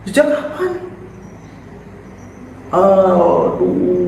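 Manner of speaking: high-pitched, startled questions; acted shock. Shown as a demonstration. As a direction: gasp out dismayed disbelief. Acted shock at missing portraits.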